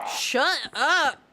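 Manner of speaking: loud, high burst of annoyance; exasperated shout. Real, not acted. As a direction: shout it to silence noisy birds. Yelling at noisy birds.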